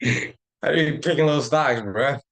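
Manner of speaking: hamming up a comic's bit about picking little stocks; Cat Williams impression. Trader riffs on Cat Williams joke, loud slow mock comic voice. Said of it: "Cat Williams when he was making fun of Michael Jackson".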